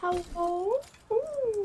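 high voice. Pretend. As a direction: squeak it up playfully. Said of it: "I don't know why you're speaking like that".

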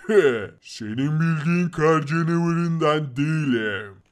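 loom over him; menacing snow monster. Acted. The snow monster taunts Fakir in a low menacing voice.

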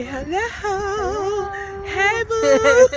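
high, loud squealed greeting; excited. Real, not acted. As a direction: squeal a happy hello. Both greeting camera excitedly.

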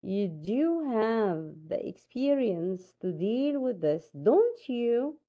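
slow reading with "do" stressed and drawn out; emphatic do. Shown as a demonstration. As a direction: stretch and stress "do" before "have", slowly. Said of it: "So I have it emphatic".

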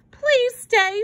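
put-on high pleading little girl; begging. A narrator's reading. Susie clutching his sleeve, begging in a high voice. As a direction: beg him to stay, voice lifted high. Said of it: "she begged".

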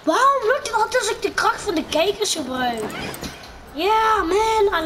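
high, loud triumphant boast; thrilled gamer hype; excited cheer. Real, not acted. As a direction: crow over the win. Celebrating success in game.

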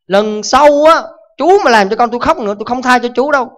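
loud, high threat; threatening father. Acted.

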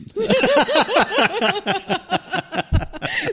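real long high-pitched laugh. Real, not acted. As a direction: laugh high and let it run on. Long laugh after the punchline.

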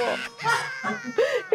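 a tearful sob burst; retold sob. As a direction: break into a sob. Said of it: "我真的大哭哎". (抽泣声)

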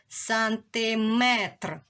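Сантиметр drawn out slowly, every sound clear; slow dictation. Shown as a demonstration. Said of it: "чітко промовили це слово". (повільне чітке промовляння)

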